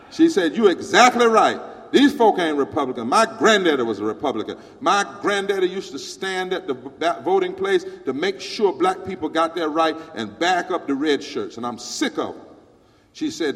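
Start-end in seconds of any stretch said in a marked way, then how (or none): 0.6-12.4 loud, preaching retold outburst; loud imitation of an angry older woman; fiery speech. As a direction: boom it out like she's fed up.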